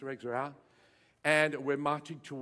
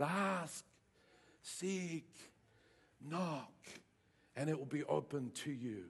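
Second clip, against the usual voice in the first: soft refrain; 3 semitones lower, 10 dB quieter, slower than usual. Acted.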